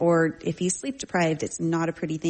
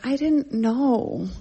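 put-on high, drawn-out child's protest; six-year-old's excuse; 7 semitones higher, much slower than usual. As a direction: imitate a little girl pleading ignorance. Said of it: "she has this little thing".

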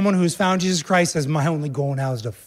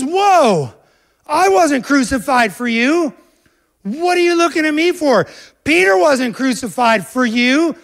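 high, loud outraged yell; Paul shouting at the divided church; screaming Paul; 9 semitones higher, 7 dB louder than usual. Acted. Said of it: "He's screaming at him".

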